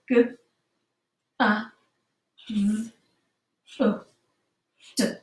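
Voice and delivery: pure phonics sounds g, a, v, o, d, each one separate; sounding out words. Shown as a demonstration. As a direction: segment gathered into pure sounds with pauses. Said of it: "use really pure sounds".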